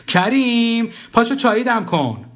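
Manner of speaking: high-pitched wifely call; calling from next room. A narrator's reading.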